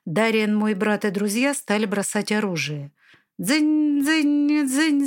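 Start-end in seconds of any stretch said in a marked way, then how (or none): 3.4-5.1 high, loud ringing dzyn; clanging swords. Acted. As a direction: ring out like clashing steel. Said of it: "звенели мечи".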